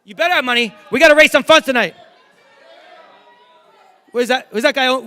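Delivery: normal talking voice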